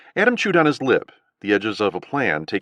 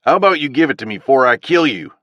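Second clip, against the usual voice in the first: louder southern snapped threat; 4 semitones higher, 7 dB louder than usual. A narrator's reading. Owl threatening Adam, angry southern snap. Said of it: "Owl snapped".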